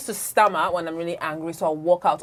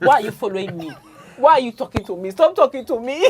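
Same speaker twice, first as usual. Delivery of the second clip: louder, mock-annoyed snapping; 4 semitones higher, 7 dB louder than usual. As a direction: act fed up with him. Acted quarrel with husband.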